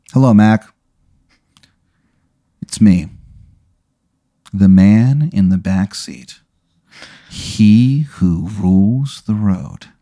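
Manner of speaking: very slow, ominous introduction; mysterious back-seat phantom. Acted. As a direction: announce yourself slowly, pausing between phrases. Voices the man in back seat.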